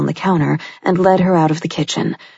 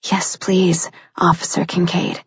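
whispered plea. A narrator's reading. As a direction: whisper it, eager. Heroine pleads softly.

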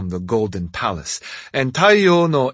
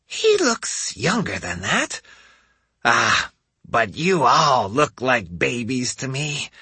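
put-on old woman voice; 3 semitones higher than usual. A narrator's reading. Old lady voice for Hansho.

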